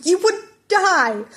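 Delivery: slow, high, loud cry of alarm; alarmed shout. Acted. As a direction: yell it in disbelief. Teacher alarmed at student.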